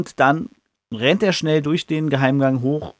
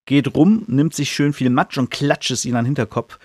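ordinary talk

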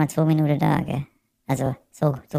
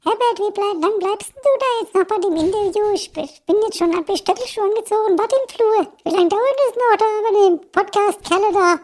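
high shrill falsetto wife calling from another room; nagging wife voice. Acted. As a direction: play the impatient wife nagging from the hallway. Wife Elfriede voiced calling impatiently.

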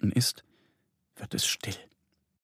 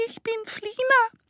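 squeaky, extremely high little bat; Flina. A narrator's reading. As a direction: introduce yourself in a tiny piping voice. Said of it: "antwortet eine piepsige Stimme". (piepsige Fledermausstimme)

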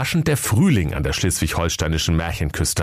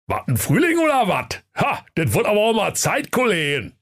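high cartoon bear voice, grumbling; a sleepy bear greets spring; waking bear; 13 semitones higher than usual. Bear waking from hibernation greets colleagues, high cartoon voice. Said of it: "und sprach".

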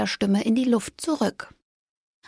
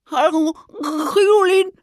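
mumbling squirrel; 8 semitones higher, 3 dB louder than usual. A narrator's reading. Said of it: "murmelte Fridolin".

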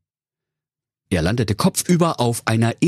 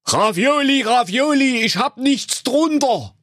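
much higher put-on suitor voice, calling up; Enrico. Enrico calling up to the tower, high put-on voice. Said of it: "und rief".

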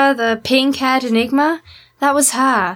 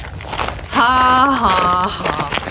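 sarcastic fake laugh; 2 semitones lower, much slower than usual. Sarcastic fake laugh at bad joke.

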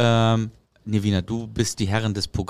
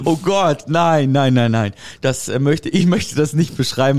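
flustered laughing protest; 4 semitones higher, 3 dB louder than usual. Real, not acted. Embarrassed laughing reaction to colleague.

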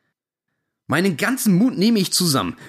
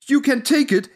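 shouted reply; 9 semitones higher than usual. Retold or imitated. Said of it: "schreit mir ein".

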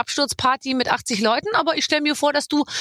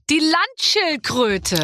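very slow, drawn-out animal name, pitched higher; quizmaster announcement; 4 semitones higher, much slower than usual. Pretend. Host announces the next animal, drawn-out quizmaster reveal. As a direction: stretch it out like a game-show reveal.

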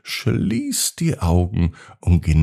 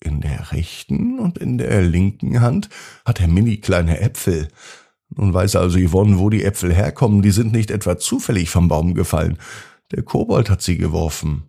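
ordinary delivery

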